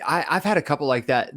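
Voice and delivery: ordinary talk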